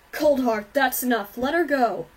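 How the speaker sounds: loud, forceful shouted command; shouting. A narrator's reading. Father ordering his wife off their daughter, shouting. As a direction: shout it out to break up the attack. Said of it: "her father shouted".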